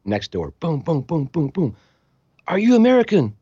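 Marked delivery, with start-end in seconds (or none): 0.8-1.8 imitated pounding. Imitating banging on doors. 2.5-3.3 high, loud soldier's shout; yelling soldier. Retold or imitated. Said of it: "they're yelling".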